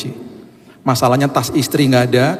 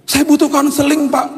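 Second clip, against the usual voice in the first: tearful plea. Retold or imitated. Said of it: "Terus dia nangis".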